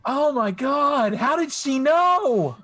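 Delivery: high, loud, slow mock horror; mad scientist. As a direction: wail it like a cartoon villain.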